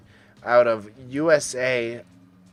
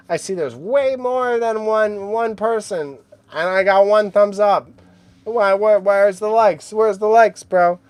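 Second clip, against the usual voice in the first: raised, high-pitched demand; loud complaint; 9 semitones higher, 6 dB louder than usual. Real, not acted. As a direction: call them out loudly. Demanding likes from viewers.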